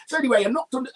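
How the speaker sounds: usual speaking voice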